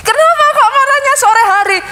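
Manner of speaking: high-pitched, mock-baffled questioning; puzzled teacher. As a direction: imitate a bewildered teacher pressing for an answer. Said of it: "kakak gurunya mulai bingung".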